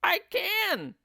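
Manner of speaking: high, loud excited cry; shrill squeal of delight from the old wife. Acted. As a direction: cry it out, thrilled to be stretched. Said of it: "cried Missus Twit".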